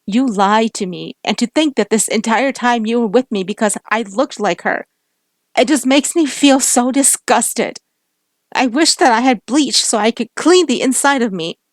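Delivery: loud, high, disgusted cry; angry shout. Acted. Heated lovers' quarrel.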